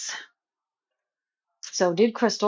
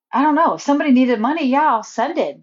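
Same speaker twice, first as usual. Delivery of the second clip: heated outburst; 6 semitones higher, 6 dB louder, faster than usual. Real, not acted. Heated defense in money dispute.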